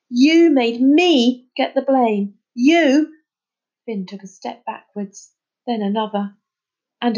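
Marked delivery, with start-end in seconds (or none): 0.1-3.1 loud high accusing yell, slow. A narrator's reading. Charlie yelling at trapped Finn, furious. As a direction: yell it right in his face. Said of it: "Charlie was yelling".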